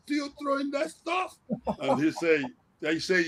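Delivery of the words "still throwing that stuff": high teasing shout-out across the field; mock yell. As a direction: needle him in a high, pushed voice. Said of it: "I yelling at him".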